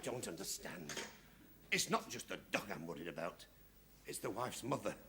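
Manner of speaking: hushed. Acted. Man drops to a quiet confession.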